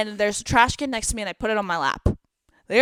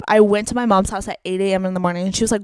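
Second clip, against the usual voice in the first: ordinary delivery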